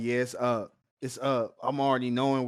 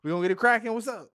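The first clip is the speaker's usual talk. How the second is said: higher, louder hyped-up taunt back; mock hyped reply; 10 semitones higher, 5 dB louder, much faster than usual. Friend playing Odysseus answering the siren, hyped up. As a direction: answer her like you're ready to fight.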